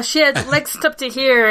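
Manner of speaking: noir detective. Acted. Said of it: "noir narration riff".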